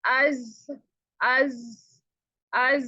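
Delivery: अज with a seen hiss mixed in, the mistake; wrong mixed z-s. Shown as a demonstration. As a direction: blur अज into s. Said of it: "हमारी sound ऐसी आती है". (गलत मिला हुआ अज)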